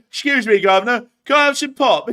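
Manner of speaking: loud, high, hammed-up Yorkshire accent; mock British accent. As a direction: parody a botched Midwestern accent as British. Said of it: "some guy from, like, Yorkshire".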